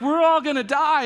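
hammed-up freak-out; retold panic. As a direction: cry out like alarmed disciples. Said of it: "they start freaking out".

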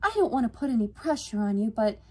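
high, earnest request; sighing appeal. A narrator's reading. Yvonne asking Alex to check on Freya, earnest. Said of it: "Her eyes widened and she sighed".

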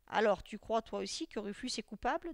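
ordinary delivery, nothing marked

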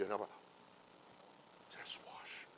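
soft whisper; whispered plea. Acted. Believer asking to be washed, hushed whisper. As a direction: breathe it like a prayer.

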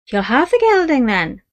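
a wheedling Irish trader; Irish horse dealer. Acted. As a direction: sell the horse with easy Irish charm. Dealer closing the sale.

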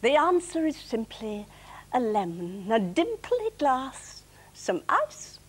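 weary comic verse, some lines slowed right down; lightly performed tired gardener poem; poem recitation. Acted. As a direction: recite it like someone worn out after a day of gardening. Recites her final gardening poem.

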